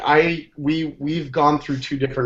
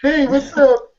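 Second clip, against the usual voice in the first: high, loud Brooklyn wiseguy; hammed Brooklyn greeting; Brooklyn accent; 10 semitones higher, 4 dB louder, much slower than usual. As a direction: slip back into the Brooklyn act. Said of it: "I'm like".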